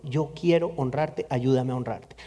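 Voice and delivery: soft prayer. Retold or imitated. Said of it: "prayer quoted".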